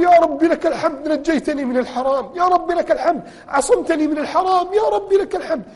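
high, tearful praise to God; retold tearful thanks, lightly voiced; weeping thanks. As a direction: relive sobbing gratitude on the ground. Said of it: "سجدت على الأرض أبكي وأقول".

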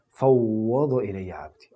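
slow, hushed and solemn; slow divine reply. Retold or imitated. Preacher quoting God's answer to His servant, slow and solemn. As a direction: let each word fall slowly and calmly. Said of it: "يقول الله سبحانه وتعالى".

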